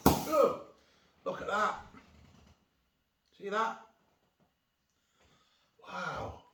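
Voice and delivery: high, loud, delighted exclamations; thrilled by the gadget working; excited. Real, not acted.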